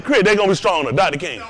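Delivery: normal talking voice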